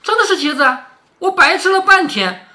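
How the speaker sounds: put-on high-pitched, astonished old granny in a storytelling voice. A narrator's reading. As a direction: act out puzzled disbelief, pitched well up. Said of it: "刘姥姥就诧异". (高声诧异地说，演出难以置信)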